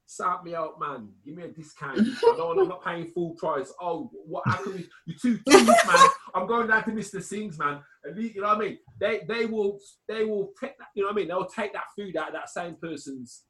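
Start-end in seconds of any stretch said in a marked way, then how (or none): 0.1-7.8 haggling customer. Retold or imitated. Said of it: "will go in there and say".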